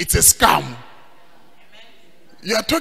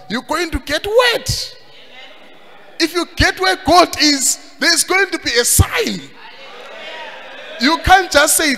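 high-pitched fervent preaching; emphatic preaching; 8 semitones higher than usual. Real, not acted. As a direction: drive the point home to the congregation. Preacher driving his point home to congregation.

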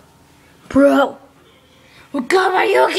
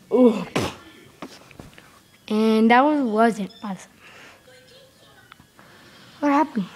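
ordinary talk, nothing marked

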